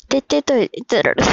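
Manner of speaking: 「てってと、いってられ」と音が崩れてもつれる; 舌が回らず「いってられ」を言い損なう; 噛んだ滑舌. Real, not acted. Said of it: "滑舌死んだ". (滑舌が崩壊したように噛む)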